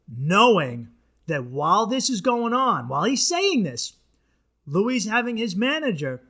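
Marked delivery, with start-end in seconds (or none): none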